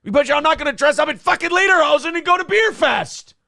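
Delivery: high, loud exasperated outburst; loud rant. Real, not acted. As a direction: blow up at the very idea. Heated rant about lederhosen.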